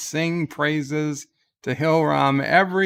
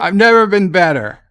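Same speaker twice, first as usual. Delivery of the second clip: sarcastic; 4 semitones higher, 8 dB louder than usual. Real, not acted. Sarcastic reply after angry rant.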